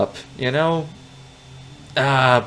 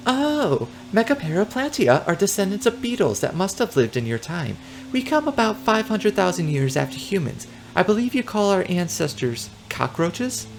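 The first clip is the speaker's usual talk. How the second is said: high-pitched giant cockroach, explaining; cockroach philosopher; 9 semitones higher, 3 dB quieter than usual. Acted.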